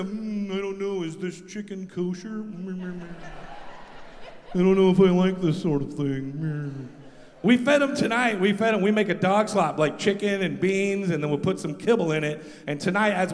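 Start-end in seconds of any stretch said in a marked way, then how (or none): 0.0-6.9 soft fussy snob; fussy picky dog voice. Retold or imitated. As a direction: sniff at it like a picky eater.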